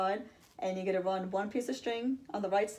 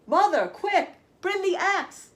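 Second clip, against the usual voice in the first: urgent loud shout, much higher; 11 semitones higher, 9 dB louder, slower than usual. Acted. Jack running home, shouting to his mother, urgent shout. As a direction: yell for help in a panic. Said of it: "he shouted".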